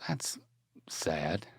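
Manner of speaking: very soft, near-whispered aside; hushed reaction. Real, not acted. Reacting to the devil beating his wife, hushed. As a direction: let it drop to a whisper, with a pause mid-phrase.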